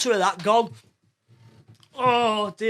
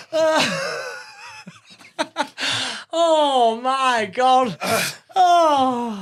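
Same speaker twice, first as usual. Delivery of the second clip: wheezing laughter; much slower than usual. Real, not acted. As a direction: wheeze it out, doubled over. Can't stop laughing.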